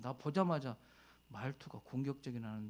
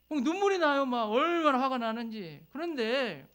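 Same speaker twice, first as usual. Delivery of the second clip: much higher and louder, near tears; emotional outburst; 10 semitones higher, 10 dB louder than usual. Real, not acted. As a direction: cry out the hurt, high and loud. Recalling tears of anger.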